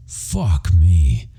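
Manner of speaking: plain reading voice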